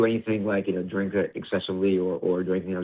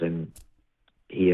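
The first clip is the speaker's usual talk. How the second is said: normal talking voice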